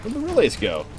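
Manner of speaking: high-pitched, incredulous outburst; real surprise. Real, not acted. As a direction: blurt it out in baffled disbelief. Fooled by a fake enemy.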